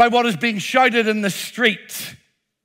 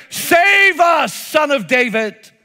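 higher, louder pleading cry; crowd shouting; 6 semitones higher, 3 dB louder, slower than usual. Retold or imitated. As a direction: call out as the street crowd. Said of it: "are shouting out".

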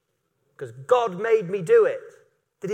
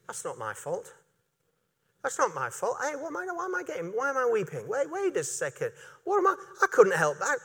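lightly voiced whining Peter, mostly softer; Peter's excuse; 4 dB quieter than usual. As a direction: act out Peter making excuses, half-mocking.

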